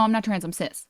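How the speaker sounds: ordinary talk, nothing marked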